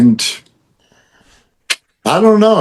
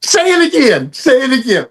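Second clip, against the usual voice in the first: high-pitched, eager begging; delighted urging; 11 semitones higher than usual. Retold or imitated. Teasing his Boston friend to repeat it, high and delighted. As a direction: egg them on in a much higher voice.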